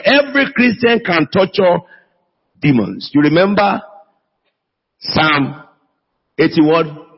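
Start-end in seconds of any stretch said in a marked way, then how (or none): none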